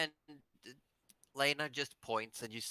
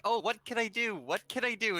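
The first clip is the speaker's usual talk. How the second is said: pleading, pitched higher and louder; imploring; 9 semitones higher, 4 dB louder than usual. Acted. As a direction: beg eagerly to be useful.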